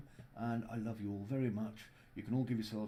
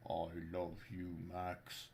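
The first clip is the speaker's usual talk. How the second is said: lower, softer, slow Scandinavian voice; tender, low Nordic lilt; low Scandinavian accent; 4 semitones lower, 5 dB quieter, slower than usual. Pretend. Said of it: "that's why I'm doing the Scandinavian accent".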